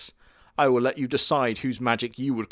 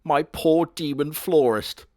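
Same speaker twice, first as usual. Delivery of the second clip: slow, hammed-up lament; mock grief; 4 semitones higher, 4 dB louder, slower than usual. Mock mourning the demon florist, slow and hammed-up. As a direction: mourn him theatrically slowly. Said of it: "Oh, sad music".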